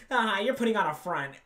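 a mocking high imitation of her; mock voice of the girl. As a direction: sneer it back in her voice. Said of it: "now you're gonna be".

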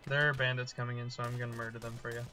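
ordinary talk, nothing marked

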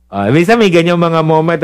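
usual speaking voice

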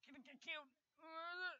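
very high-pitched squeal of alarm; mild panic. Real, not acted. Gamer flashed and expecting death, in high-pitched panic. Fears dying while flashed.

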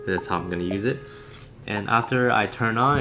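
ordinary delivery

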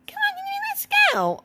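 very high cartoon squeak; squeaky little character. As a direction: urge them on in a tiny squeaky voice. Voices friend urging on.

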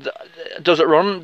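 ordinary talk

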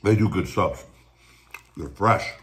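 talking between bites; muffled by food; mouth full, chewing. Real, not acted. Seen 3: Took a bite at -7; food visible in open mouth at +0.3, +2.2.

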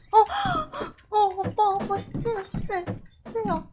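high, startled announcing; eager alarm at the find; excited. Real, not acted. Spots a corpse in game.

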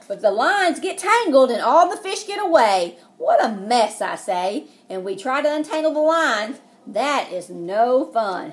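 mock-dismayed read-aloud; reading to children. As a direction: read the tangle as a mess. Story narration, mess scene.

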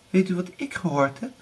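put-on high girlish voice; a girl sharing a rumour; younger daughter.

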